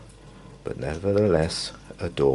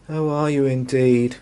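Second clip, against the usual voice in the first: sniffy retort; 7 semitones higher, 3 dB louder than usual. Acted.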